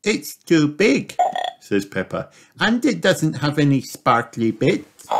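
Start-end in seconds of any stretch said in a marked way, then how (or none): 0.0-1.2 high slow little piglet voice; patient little girl piglet explaining to Daddy; Peppa's voice. Acted. 2.6-5.1 put-on high little girl piglet asking; child piglet wondering where the jumpers are; Peppa's voice.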